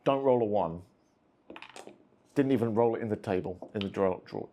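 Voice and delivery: ordinary talk, nothing marked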